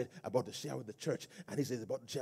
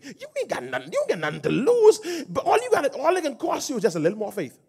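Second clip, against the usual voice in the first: loud, high-pitched preaching building to a roar; full-force preaching; 8 semitones higher, 25 dB louder than usual. Real, not acted. Preacher urging congregation to believe God, full-force shouted preaching. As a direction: preach it at the top of your voice.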